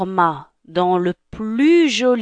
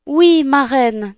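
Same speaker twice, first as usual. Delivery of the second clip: Cinderella voice; 6 semitones higher, 5 dB louder, slower than usual. Acted. Cinderella grateful.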